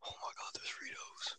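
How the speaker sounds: thrilled but unvoiced whisper; excited whisper. Real, not acted. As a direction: squeal it in a whisper.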